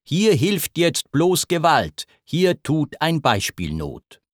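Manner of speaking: ordinary delivery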